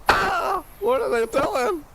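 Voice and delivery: exasperated falsetto whine. Real, not acted. Frustrated at stream.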